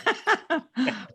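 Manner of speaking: quick real burst of laughter; short laugh. Real, not acted. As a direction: laugh at being called on first. Laughs at being called first.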